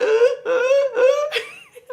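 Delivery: high, loud squeaky wheezing laugh; imitated asthma laugh. Teasing someone who freaked the dog out, mock squeaky laugh. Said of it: "don't start the asthma laugh".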